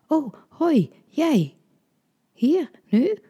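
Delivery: flustered, high, halting stammer; nervous stammering surprise. A narrator's reading. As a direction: stumble over the words, caught off guard. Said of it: "stamelde ik".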